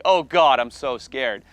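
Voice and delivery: loud retold wail, high then easing down; acted fear. Speaker dreads his turn, loud acted fearful outburst. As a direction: cry out in mock dread.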